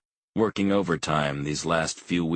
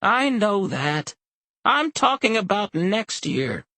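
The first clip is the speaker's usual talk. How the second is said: put-on much higher, louder old woman snapping back; snapping old mother; 4 dB louder than usual. A narrator's reading. Elderly mother to her husband, snapping back. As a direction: snap back at him indignantly.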